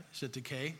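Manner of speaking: Syntyche said as SIN-tuh-kay; pronounced ending in KAY. Shown as a demonstration. Preacher offering congregation a name variant, Syntyche ending in "kay". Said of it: "It's all pronounced all three different".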